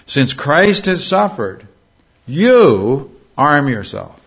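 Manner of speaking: high, slow build to a stretched "you"; pause, then land hard on "you" before "arm yourself"; stress on YOU. Shown as a demonstration. Preacher applying the verse to his listeners, stress on YOU. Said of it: "this puts an emphasis on the subject".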